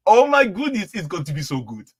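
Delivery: put-on high, playful Nigerian accent. Streamer hyping a book on African spirituality, in a Nigerian accent. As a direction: put on a bright Nigerian voice for fun. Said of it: "how was my Nigerian accent?".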